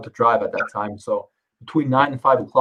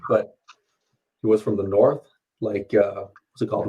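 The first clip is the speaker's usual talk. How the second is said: usual speaking voice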